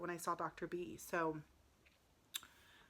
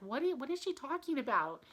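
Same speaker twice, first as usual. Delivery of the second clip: high-pitched, baffled disbelief; can't believe what you're hearing; incredulous; 6 semitones higher than usual. Retold or imitated. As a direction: replay your bewildered inner reaction. Retelling her disbelief at doctor.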